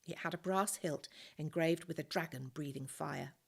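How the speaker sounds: ordinary delivery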